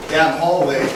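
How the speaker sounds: ordinary talk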